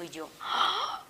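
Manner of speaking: a high, slow drawn-out ah of frustration; exasperated cry. Retold or imitated. In chronic pain, a drawn exasperated cry. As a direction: let the ah stretch up in despair.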